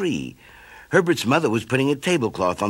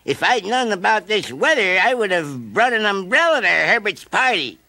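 high, sighing caterpillar voice; 10 semitones higher, 4 dB louder than usual. A narrator's reading. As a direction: sigh at the rain. Caterpillar talks in rain.

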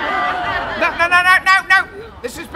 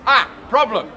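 ordinary delivery

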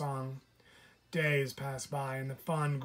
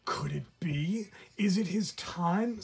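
sputtering; 5 semitones higher than usual. Acted. Raccoon up in the tree, sputtering with excitement about the fawn. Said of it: "sputtered the raccoon from above".

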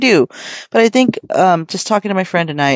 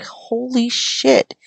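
high, slow, drawn-out amazement; stunned holy shit; 7 semitones higher, much slower than usual. Retold or imitated. Marvelling at how much merch a seller has, slow high awe. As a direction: let it stretch out in disbelief. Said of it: "And it's just like".

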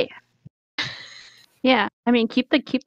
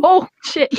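startled; 8 semitones higher, 6 dB louder than usual. Real, not acted. Fire catches near her fingers.

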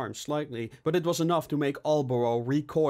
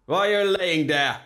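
loud, raised scolding; heavy voice, higher and forceful; heavy familiar voice; 6 semitones higher, 7 dB louder than usual. A narrator's reading. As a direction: boom it down at someone lying there. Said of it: "asked a heavy, familiar voice".

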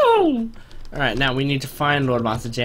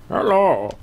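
slow, higher, lightly put-on villain voice; a hammed-up Bane, dragging words; Bane impression; 4 semitones higher, slower than usual. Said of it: "What other impressions".

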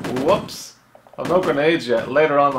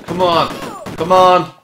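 loud, higher-pitched "come on"; urging shout; 6 semitones higher, 7 dB louder, slower than usual. Real, not acted. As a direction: call out to hurry it along. Urging the game along.

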